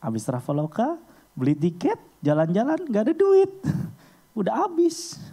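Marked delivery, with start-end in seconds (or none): 2.9-5.2 high laughing cry of being broke; laughing dismay. Retold or imitated. To the congregation, high laughing dismay at an empty wallet. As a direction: laugh out that the money is all gone. Money gone after the trip.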